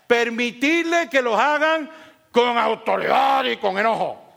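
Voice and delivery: loud, drawn-out angry shout, hammed up; mock stern authority. Pastor misquoting the verse to his congregation, mock angry shout. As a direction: thunder it like an overbearing ruler.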